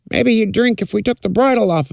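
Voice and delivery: put-on stable boy voice.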